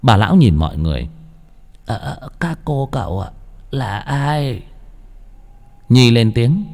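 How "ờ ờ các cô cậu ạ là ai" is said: soft, higher, hesitant granny voice; halting elderly woman; doddering old woman. A narrator's reading. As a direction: question the strangers like a feeble old crone. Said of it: "một bà cụ lụ khụ".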